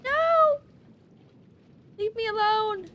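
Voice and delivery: very high, loud protest; frustrated shriek. Real, not acted. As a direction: shriek at the enemy to back off. Attacked in game.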